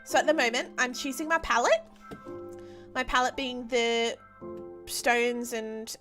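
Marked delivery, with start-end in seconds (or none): none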